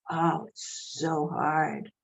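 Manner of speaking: slow, drawn-out pitying echo; mock pity. Echoing pity about her daughter studying law, slow and mocking. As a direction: mimic their pity, stretching it out slowly. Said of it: "they look at me with such pity".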